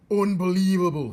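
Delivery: high, loud outraged shout; loud, high cry of outrage. A narrator's reading. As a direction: bellow it in disbelief. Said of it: "he shouted".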